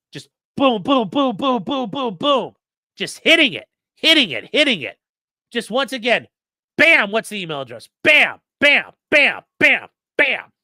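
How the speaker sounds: punchy high, loud bursts of booms and bams; relentless hammering. Acted. Streamer showing how top attorneys hit a witness in depositions, relentless hammering. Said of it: "this is what he sounds like".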